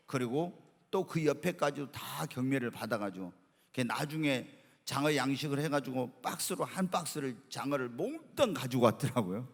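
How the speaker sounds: normal talking voice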